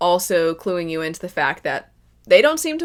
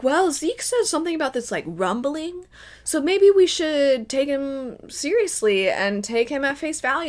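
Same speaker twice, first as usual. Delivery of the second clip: mock naive reasoning; 7 semitones higher than usual. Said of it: "I don't know how anybody's like".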